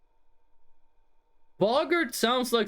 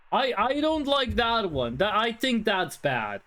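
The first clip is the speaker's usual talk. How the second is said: ordinary delivery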